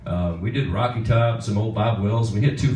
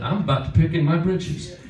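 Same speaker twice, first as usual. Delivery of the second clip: higher-pitched imitation of a folksy singer; comic impersonation in a raised voice; 7 semitones higher than usual. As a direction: mimic his pet saying, pitched up. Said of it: "That's the way he would say it".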